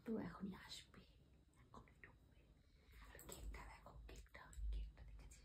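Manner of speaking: hushed excited whisper. Real, not acted. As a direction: whisper before he walks in. Hushing before dad enters.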